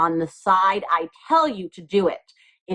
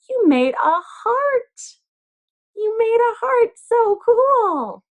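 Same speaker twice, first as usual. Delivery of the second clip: delighted gasp; 12 semitones higher, slower than usual. Pretend. Teacher revealing the folded heart to children, delighted gasp and high amazement. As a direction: reveal it like a magic trick to a class of small kids.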